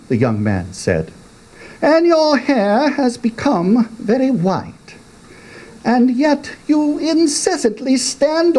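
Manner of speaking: high questioning youth voice; young man. A narrator's reading. Young man questioning his father, high voice. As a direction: needle the old man in a high voice.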